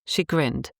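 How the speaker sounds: usual speaking voice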